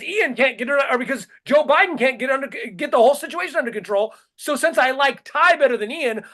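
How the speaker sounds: exasperated rant. Real, not acted. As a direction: vent in outraged disbelief. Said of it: "Somebody fucking help me".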